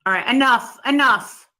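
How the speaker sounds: loud, exasperated cut-off; annoyed. Real, not acted. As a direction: slam the conversation shut. Cutting off the argument.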